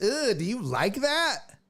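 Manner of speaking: slow, coy little question; a hint of a girl shyly testing the waters. Girl coyly asking her man, slowly and shyly. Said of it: "they gotta be like".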